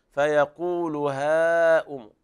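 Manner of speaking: هاؤم with the alif stretched long before the hamza; long madd. Shown as a demonstration. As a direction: hold the aa in هاؤم for the full madd. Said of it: "هذا مد واجب متصل". (مد متصل في هاؤم)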